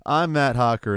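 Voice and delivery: loud, slow, booming talk-show host welcome; show host intro. Acted. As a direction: announce the show like a big TV host. Host opens his comedy show.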